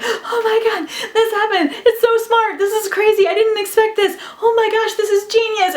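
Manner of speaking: high-pitched, breathless-with-excitement fangirl gush; giddy, over-the-top amazement; excited gushing. Retold or imitated. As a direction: re-enact marveling to your husband, voice way up. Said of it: "looking at my husband and being like".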